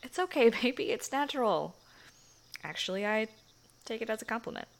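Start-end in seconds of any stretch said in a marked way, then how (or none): 0.0-1.8 amused, playful comfort; laughing reassurance. Acted. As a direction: giggle then louder, higher reassurance. Amused reassurance after joke.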